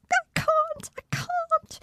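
very high, breaking plea; despairing whimper. Acted. Hurt child whimpering she cannot go on. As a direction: near tears, giving up. Pained aside mid-speech.

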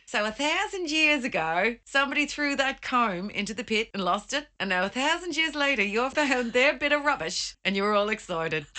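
put-on Australian accent. Australian digger deflates the excited pair, Australian accent. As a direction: play an unimpressed Australian explaining. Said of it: "Spanish and Australian".

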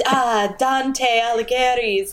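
slow, showy Italian pronunciation; pretentiously drawn-out Italian name; hammed-up Italian accent. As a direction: imitate an overdone Italian accent. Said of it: "he'll, like, read".

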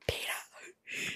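barely audible whispered name. Acted. Girl calling softly to hidden Peeta, whispered. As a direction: whisper it as if searching nearby. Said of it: "she whispers".

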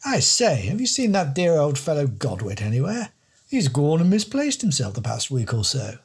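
high plummy English don voice; posh don. Acted. The don asking the detective about Godwit, high plummy voice.

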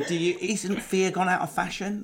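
ordinary talk, nothing marked

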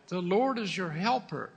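usual speaking voice

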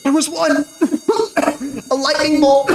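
high, strained, coughing out words; coughing wounded woman. Acted. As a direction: choke the words through smoke. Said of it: "Grace is coughing through the smoke".

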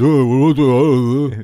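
hammed-up fatherly grumbling outburst; dad impression. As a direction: imitate dad sputtering in a commotion. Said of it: "that's my dad impression".

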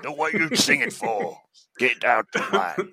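loud, high mock heckle; working class heckle. Working-class podcaster mocking arty theatre, loud heckle. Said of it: "that's my working class roots coming".

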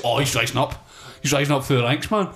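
ordinary talk, nothing marked

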